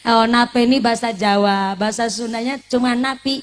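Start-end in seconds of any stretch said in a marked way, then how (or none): none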